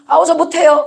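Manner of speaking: protesting slave. Acted. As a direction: act out a slave daring to object. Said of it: "말할 수 있습니까?". (못 하겠다고 항변하듯 높은 목소리로)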